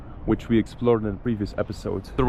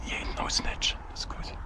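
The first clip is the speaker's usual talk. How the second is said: hushed whisper, sneaking past a dog; 10 dB quieter than usual. Real, not acted. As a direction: whisper so the guards won't hear.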